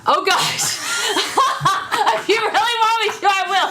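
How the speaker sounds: high, loud laughing protest; flustered laughter. Real, not acted. Songwriter asked to make up a hook on the spot, flustered laughing. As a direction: squeal through laughter as you give in.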